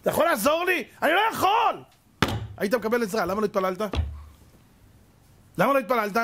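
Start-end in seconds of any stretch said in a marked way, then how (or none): 0.0-1.8 desperate plea. Retold or imitated. A man crying out to God, high and desperate. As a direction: beg as if drowning.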